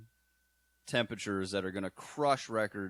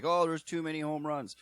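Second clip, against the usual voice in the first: higher-pitched mock whining about a problem; mock complaint; 8 semitones higher, 3 dB louder than usual. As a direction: imitate people complaining. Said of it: "they're like".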